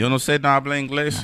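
put-on joking Spanish accent, deflecting; playful mock Spanish dodge. Dodging his co-host's question, in a playful mock Spanish accent. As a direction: toss off in fake Spanish to dodge.

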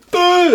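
high, loud burst of fake bawling; mock crying wail. Boy crying at uncle's prank, mock wail. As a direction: imitate a kid's sudden howl. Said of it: "'cause I was crying".

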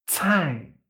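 cai as "tsʰai" with a strong puff of air; cai, breathy ts and falling tone; aspirated fourth-tone cai. Shown as a demonstration. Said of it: "sale bastante más aire".

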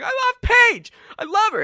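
very high, loud declaration; squeaky-high lovelorn holler; lovesick yelling. Acted. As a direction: shout your love over the interruption. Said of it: "you guys hear him clearly".

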